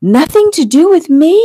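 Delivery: high, loud, indignant question; screaming outrage. A narrator's reading. Fiancée hears it has nothing to do with her, screaming. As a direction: shriek it back at him in disbelief. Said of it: "She was screaming now".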